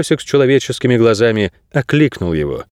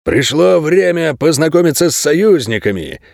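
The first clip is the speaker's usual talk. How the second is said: higher, ceremonial proclamation; solemn announcement; 6 semitones higher than usual. A narrator's reading. Lenny presents the allies to the pack, solemnly. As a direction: announce it grandly, pitch lifted. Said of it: "торжественно объявил Ленни".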